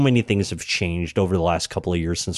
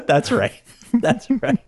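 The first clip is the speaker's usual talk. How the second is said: laughing; 14 semitones higher than usual. Real, not acted. Laughing agreement.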